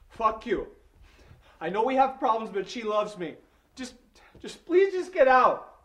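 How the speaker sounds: high, loud, desperate yell; slow at the end, pleading; furious shout. Acted. Furious at intruder's claims.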